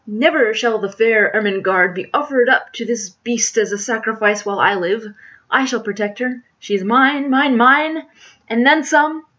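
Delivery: loud, impassioned melodrama hero; virile declaiming. Acted. Hero Jack vows to protect family, declaiming virilely. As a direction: declaim it boldly, voice ringing out. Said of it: "declaiming in appropriately virile accents".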